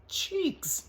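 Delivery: high, soft imitation of delight; super happy. Imitating son happy about what he saw, delighted. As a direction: savor it in a high, small voice. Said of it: "super happy about seeing".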